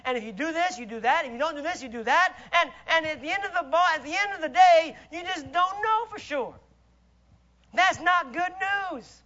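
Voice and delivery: ordinary talk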